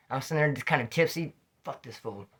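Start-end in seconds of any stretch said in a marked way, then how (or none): 1.6-2.3 muttered thought. Retold or imitated.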